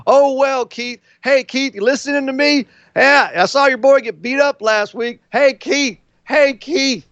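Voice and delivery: mocking Grimes. Heckler taunting Keith from behind, in a high mocking voice. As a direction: needle him in a whiny high heckler voice. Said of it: "and saying".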